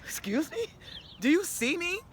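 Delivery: high, incredulous, pointed; mock-offended retort; indignant disbelief. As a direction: demand they look at her, outraged. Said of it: "I'm like".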